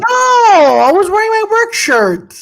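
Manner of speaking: high, exasperated denial; squawked, offended correction; indignant protest. Real, not acted. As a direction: protest your innocence, voice shooting up. Protesting a teasing accusation.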